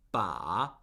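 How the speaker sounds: "ba" dropped low, dipping then lifting. Shown as a demonstration. Teacher shows four different Mandarin words, dipping tone. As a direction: scoop "ba" down low and back up. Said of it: "those are four completely different words".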